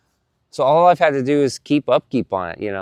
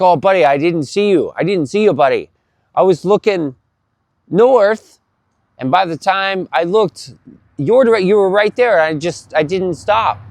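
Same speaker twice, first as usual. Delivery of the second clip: higher-pitched, lightly acted excuse; apologetic driver; 5 semitones higher, 4 dB louder than usual. Driver excusing himself after the crash, lightly acted apology. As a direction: imitate a driver pleading he didn't see.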